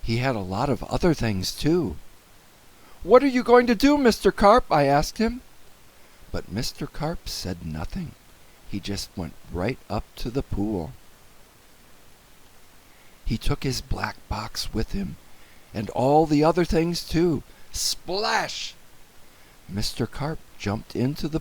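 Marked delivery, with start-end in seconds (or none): 3.0-4.6 high, loud and urgent; anxious question. A narrator's reading. Boy asks urgently. 17.7-18.7 high, hushed burst; dramatic splash. A narrator's reading. Narrator voices a splash.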